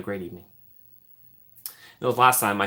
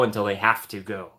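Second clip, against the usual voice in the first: ordinary talk, nothing marked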